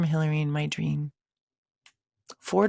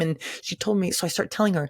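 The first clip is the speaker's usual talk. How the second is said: ordinary talk, nothing marked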